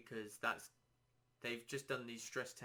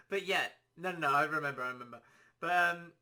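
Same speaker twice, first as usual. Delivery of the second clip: laughing; 4 semitones higher, 10 dB louder, faster than usual. Real, not acted. Still laughing, recovering his thought.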